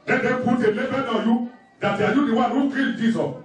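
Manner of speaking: ordinary talk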